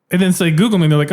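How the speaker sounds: ordinary talk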